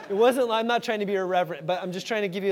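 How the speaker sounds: ordinary talk, nothing marked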